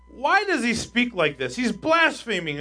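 high, loud, slow accusation; scandalized, drawn-out protest; acted indignation. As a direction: play the outraged scribes objecting. Voices scribes' indignant accusation.

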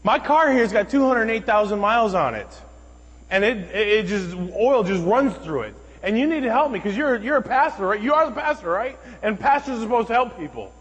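high, loud imitation of a demanding stranger; insistent woman's tirade.

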